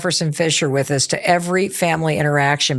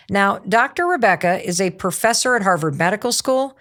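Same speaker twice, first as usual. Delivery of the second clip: normal talking voice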